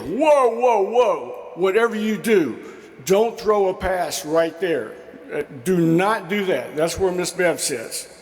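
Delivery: high, hammed-up whoa of alarm; mock-alarmed coach. Coach warning the team never to hit Miss Bev's seat, high mock alarm. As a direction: play the coach sounding the alarm, half joking.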